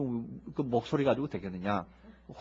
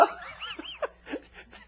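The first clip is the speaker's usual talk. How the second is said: high fading laugh; 10 dB quieter than usual. Real, not acted. Laughing mid-quote.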